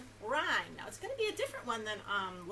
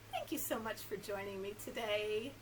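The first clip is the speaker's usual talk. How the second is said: usual speaking voice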